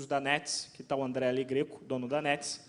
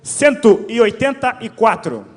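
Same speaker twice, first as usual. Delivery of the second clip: slow, loud, drawn-out number call; announcer call; 4 semitones higher, 15 dB louder, slower than usual. Real, not acted. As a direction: call the number like a raffle host.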